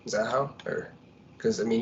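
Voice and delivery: ordinary delivery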